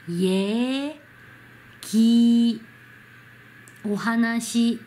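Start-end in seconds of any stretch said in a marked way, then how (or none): 0.1-2.6 イエ ギ in slow, separated syllables "yeh... gi"; 発音のお手本. Shown as a demonstration. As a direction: hold each syllable of イエ ギ, pausing between them. Teacher models a Korean word. (イエ ギを一音ずつ伸ばして読む手本)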